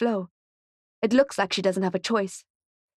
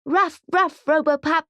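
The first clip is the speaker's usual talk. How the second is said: much higher, louder group shout; high, loud children's chant; kids shouting back; 8 semitones higher, 5 dB louder than usual. A narrator's reading. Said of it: "The kids all shout back".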